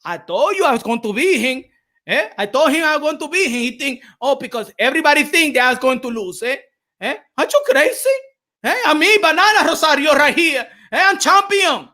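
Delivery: put-on brash Dominican accent, voice up high; Rosario post-fight interview. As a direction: crow like the new champ in the ring. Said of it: "the post interview if he beat Charlo".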